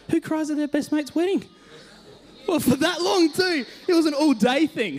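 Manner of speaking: very high, exasperated self-mockery; amused disbelief. Real, not acted. Mocking himself for crying at mate's wedding, incredulous.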